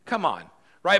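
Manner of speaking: ordinary delivery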